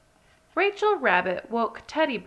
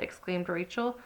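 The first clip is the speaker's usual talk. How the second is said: ordinary delivery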